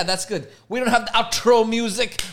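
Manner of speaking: normal talking voice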